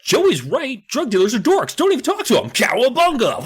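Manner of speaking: high, cartoonish turtle hero voice; Donatello voice. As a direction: ham up a cheesy cartoon turtle lesson. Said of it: "Donatello comes in and is like".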